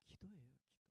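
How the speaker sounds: ordinary talk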